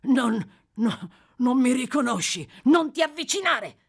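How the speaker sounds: high, loud refusal, rising in pitch; stammer, then cry out to keep him away; horrified exclamation. A narrator's reading. Boy backs away from the murderer in horror, crying out. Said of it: "esclamò il ragazzo, sempre indietreggiando".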